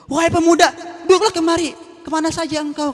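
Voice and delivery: put-on high, eager old beggar calling out; a beggar welcoming a young man; blind beggar voice. Beggar greets the youth, high and eager. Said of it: "katanya pengemisnya".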